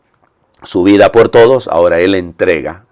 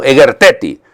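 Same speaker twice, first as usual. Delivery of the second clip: firm, somewhat raised order; strong, higher-pitched imperative; forceful command; 6 semitones higher, 5 dB louder than usual. Acted. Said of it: "le dijo con fuerza".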